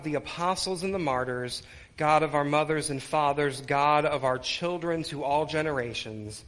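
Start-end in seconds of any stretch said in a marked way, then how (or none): none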